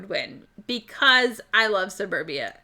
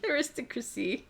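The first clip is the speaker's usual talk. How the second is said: Aristocracy murmured softly in a changed voice; quiet aside; 8 dB quieter than usual. Shown as a demonstration. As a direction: drop Aristocracy to a soft aside.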